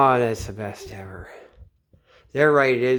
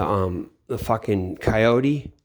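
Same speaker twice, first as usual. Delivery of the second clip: ordinary delivery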